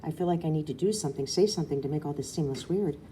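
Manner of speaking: usual speaking voice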